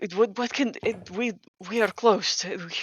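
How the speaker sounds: put-on flustered old man tripping over his words; terrified older gentleman. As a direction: stammer nervously at frightening callers. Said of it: "terrified even".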